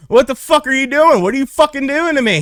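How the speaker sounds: high, loud outraged yell; cuffed man protesting to the officer. Retold or imitated. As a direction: shout it up high in disbelief. Being handcuffed by cop.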